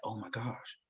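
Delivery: soft, low, awed whisper of discovery; hushed, slow dawning wonder; quiet realization. Retold or imitated. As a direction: breathe it out as you realize. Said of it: "And I said".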